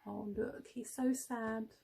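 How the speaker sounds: plain reading voice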